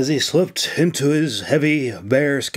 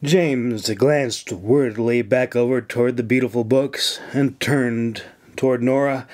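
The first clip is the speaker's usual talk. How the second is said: usual speaking voice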